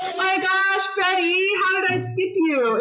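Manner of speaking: high, startled oh my gosh; sudden realisation, pitched high; teacher surprised at skipping Betty. Real, not acted.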